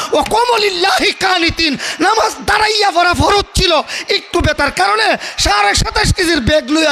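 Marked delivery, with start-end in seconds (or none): none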